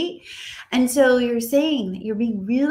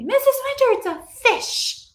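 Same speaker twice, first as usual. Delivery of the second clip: put-on young students answering; 14 semitones higher, 5 dB louder than usual. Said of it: "And they're gonna say".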